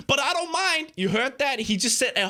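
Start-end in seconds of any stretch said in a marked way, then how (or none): 0.1-0.9 high-pitched mocking quote; mock-innocent, pitched up high; mocking imitation. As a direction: parrot the other man in a higher voice. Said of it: "that was a way of him, again, doing the whole insult".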